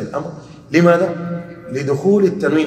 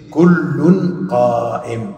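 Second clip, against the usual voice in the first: كل قائم spelled out very slowly and louder; slow demonstration; 7 dB louder, much slower than usual. Shown as a demonstration. Teacher to class, stressing the tanween on كل slowly. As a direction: stretch كل قائم so the tanween on كل is heard. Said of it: "عوض عن كلمة".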